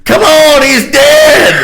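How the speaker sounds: very high, loud mock shout; hollering. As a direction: holler it up high, imitating a panicked kid. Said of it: "She's hollering for her sister".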